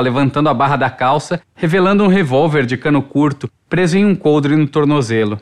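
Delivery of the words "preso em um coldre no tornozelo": usual speaking voice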